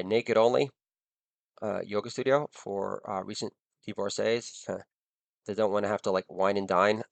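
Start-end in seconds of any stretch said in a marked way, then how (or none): none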